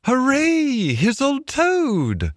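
high, squeaky joyful Mole cheer; beaming cry. A narrator's reading. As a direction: cry it out high, drawing out the name. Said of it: "cried the mole, his face beaming".

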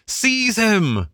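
high, outraged cry of the party; the party turning on Toad; angry cry. A narrator's reading. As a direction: cry out to grab him. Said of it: "they cried".

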